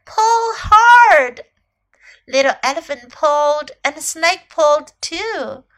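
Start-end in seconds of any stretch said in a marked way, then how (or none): 0.0-1.5 high, very loud urging; called command. A narrator's reading. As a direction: urge them on at the top of your voice. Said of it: "Snake called to Little Elephant".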